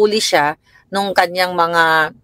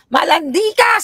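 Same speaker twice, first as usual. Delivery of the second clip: high scolding shout; tearful shouted confrontation; 11 semitones higher than usual. Retold or imitated. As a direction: scold her, pitch high. Mother scolding daughter, retold.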